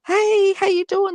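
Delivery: high, slow, hammed-up cheerful hello; mock friendly greeting. Tattooed skinheads greeting her mum, a mock bright high hello. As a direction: play the tough guys suddenly all sweet.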